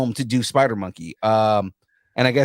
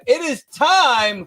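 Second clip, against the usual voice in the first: high, drawn-out announcer call; grand announcement; 13 semitones higher, 4 dB louder, much slower than usual. Pretend. Host heralding the trailer, grand announcement. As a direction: proclaim it, stretching the last word.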